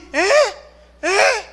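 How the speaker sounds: put-on high, abrupt old man's "eh?"; vieux père dur d'oreille. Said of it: "es que le hablo y".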